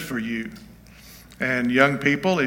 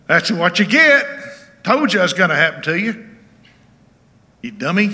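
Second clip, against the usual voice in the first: higher and louder, sneering gloat; mocking told-you-so; 6 semitones higher, 7 dB louder than usual. Preacher imitates an unsympathetic person, mocking and gloating. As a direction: gloat over someone's misfortune.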